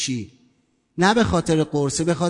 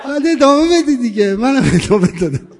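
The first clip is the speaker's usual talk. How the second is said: laughing; 4 semitones higher, 4 dB louder than usual. Real, not acted. Laughs at his own story.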